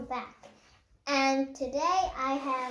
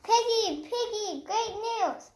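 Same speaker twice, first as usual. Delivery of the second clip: excited; 6 semitones higher than usual. Acted. Gerald excitedly calls Piggy.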